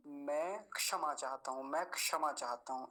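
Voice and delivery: मैं क्षमा with क tied to मैं, मैंक षमा; joined क्ष demo. Shown as a demonstration. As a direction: link the क onto मैं. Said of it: "ऐसे करके".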